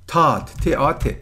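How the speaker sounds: تات spelled slowly, ت آ ت, each sound separate. Shown as a demonstration. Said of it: "spelling the word slowly". (تات آرام و حرف به حرف)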